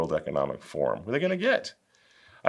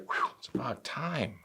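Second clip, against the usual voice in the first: whispered, much softer aside; breathy whispered relief; relieved whisper; 10 dB quieter than usual. Retold or imitated. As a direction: whisper it like the crowd is finally relieved. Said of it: "everybody's like".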